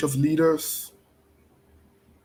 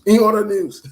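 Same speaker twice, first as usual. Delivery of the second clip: higher, louder mock announcement; playful catchphrase; 5 semitones higher, 6 dB louder than usual. Host repeating a line his friend likes, loud and playful. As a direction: punch it up like a segment jingle line.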